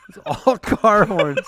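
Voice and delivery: laughing. Real, not acted. Laughing at alien joke.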